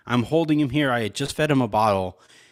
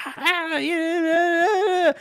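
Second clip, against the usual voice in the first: high-pitched imitated baby crying; escalating baby wail; much slower than usual. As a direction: wail like a baby working up to screaming. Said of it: "escalating in the background. He's like".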